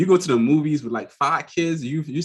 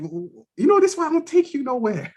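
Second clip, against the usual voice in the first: mock scolding; 13 semitones higher than usual. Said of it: "I'm like".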